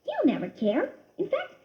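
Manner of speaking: high little mouse voice talking himself into it; a mouse justifying a sly trick; scheming little mouse. A narrator's reading. Christopher voiced, scheming aloud.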